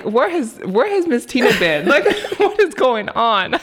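high, laughing mock disbelief; excited laughing. Fan gushing to Tina about her cover, high and laughing.

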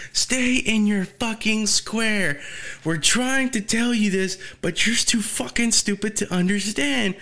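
acted-out angry telling-off; grilling her. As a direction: chew someone out in a high, strained mock rant. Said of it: "He's just grilling her the fuck out".

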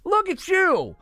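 high, exasperated telling-off; mock scolding. Real, not acted.

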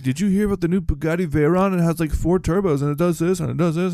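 put-on high school kid bragging about cars; car bragger. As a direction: gush about a supercar. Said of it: "Or just like".

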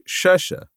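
"Treasure?" mimicked high, as in "tʃɛʃə"; mocking the word. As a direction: parrot back "Treasure?" with a high question. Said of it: "What did you say?".

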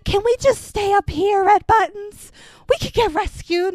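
high-pitched mock pleading; mocked whiny pleader. Mocking the frightened Nani's plea.

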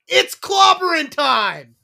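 loud, high, drawn-out catchphrase yell; battle-cry yell. Acted. Co-host bursting into relatives' houses, catchphrase yelled. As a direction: yell it slowly like a rallying cry. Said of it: "and yells".

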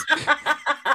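high bursting laugh; burst of laughter. Real, not acted. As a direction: laugh it out, caught off guard.

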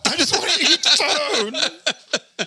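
high wail with drawn-out last word, laughing; mock whining. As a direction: drag it out into a high comic moan. Echoes the skinny people's complaint.

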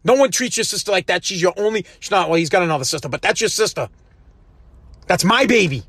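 ordinary talk, nothing marked

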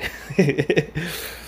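real chuckle trailing into breath; breathy laugh. Real, not acted. Man amused at his own bragging, breathy laugh. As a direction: laugh at your own joke, running out of air.

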